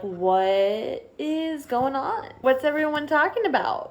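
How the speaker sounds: drawn-out bewildered question; mock-confused teenager; imitating confused Harry. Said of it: "being like".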